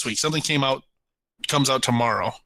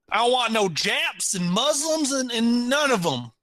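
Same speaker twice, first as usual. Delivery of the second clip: mock bigot rant; 7 semitones higher than usual. Mocking a bigoted movie character.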